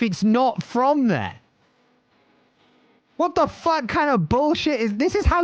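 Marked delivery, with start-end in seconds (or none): 3.2-5.4 exasperated. Real, not acted. Outraged at the game.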